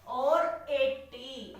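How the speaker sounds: slow, drawn-out teacher dictation. Shown as a demonstration. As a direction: stretch each sound out very slowly. Teacher dictating digits while writing.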